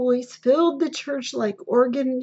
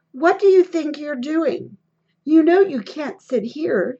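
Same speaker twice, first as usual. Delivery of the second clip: loud, scolding clerk; clerk's rebuke; 3 semitones higher, 6 dB louder than usual. Acted. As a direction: snap at them to move, loudly.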